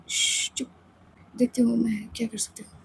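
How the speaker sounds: soft hushing whisper; hushed shush. Acted. Samaira hushing Aditi while hiding in the bathroom, whispered. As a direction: keep it down so nobody hears.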